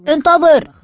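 high, loud shout after someone; calling out. A narrator's reading. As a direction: call out to stop a runner. Calling after a running friend.

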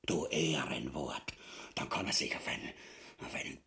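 low, soft, whispered threat; menacing whisper. Acted. Ubu to his wife, whispering a threat against the king. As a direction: hiss a promise of violence.

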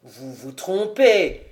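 low, slow, taken-aback denial; mild stammer. A narrator's reading. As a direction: object haltingly in a deep voice. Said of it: "balbutia le capitaine". (objection grave et hésitante)

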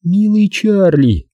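high, drawn-out endearment; fond, lingering sweetness; affectionate. A narrator's reading. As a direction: coo it slowly in a high female voice. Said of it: "Она бросила на него ласковый взгляд".

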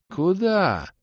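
very high, drawn-out question; lightly voiced airy elf; dreamy elf. A narrator's reading. Elf asks the hero where, high and drawling.

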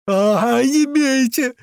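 high, loud pained cry; yelling in pain. A narrator's reading. Captive kicked in the ribs begs them to stop, yelling in pain. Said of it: "заорал тот от боли".

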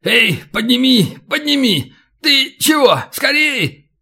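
high-pitched frantic calls for help; urgent, high storybook shout; panicked yelling. A narrator's reading. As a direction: yell up in alarm, voice pitched high. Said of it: "задёргался и заорал".

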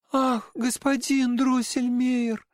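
put-on high girlish exclamation; Marie's voice. A narrator's reading. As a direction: cry out as a little girl. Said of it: "воскликнула она".

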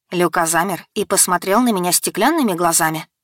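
plain reading voice

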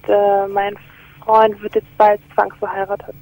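ordinary talk

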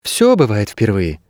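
lightly philosophical remark. A narrator's reading. Girl replying to the dog, philosophical. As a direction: offer it as a small truism. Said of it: "философски заметила девчонка".

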